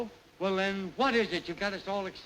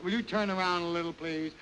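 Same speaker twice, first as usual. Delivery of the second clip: usual speaking voice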